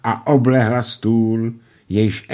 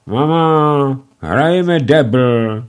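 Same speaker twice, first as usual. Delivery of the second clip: higher, slow, whiny plea; sulky insisting; 6 semitones higher, 5 dB louder, much slower than usual. A narrator's reading. Bessie insists to mama on doubles, sulky whine. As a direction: nag her mother grumpily. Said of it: "naléhala mrzutě Bessie".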